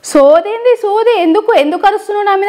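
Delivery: high-pitched indignant prank scolding; put-on outrage. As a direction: fire back at the caller, feigning fury. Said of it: "నేను fire మీద మాట్లాడినా".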